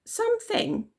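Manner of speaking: very high puzzled query; puzzled question. A narrator's reading. Zoe puzzled by Elsie's request, very high. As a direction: ask in surprise. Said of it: "asked Zoe".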